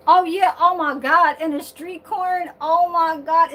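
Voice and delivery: normal talking voice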